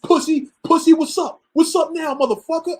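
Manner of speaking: imitated furious, taunting tirade; angry. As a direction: act out a vindicated friend cussing someone out. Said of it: "And he's angry".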